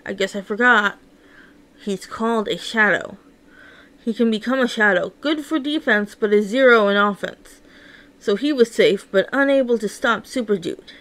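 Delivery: usual speaking voice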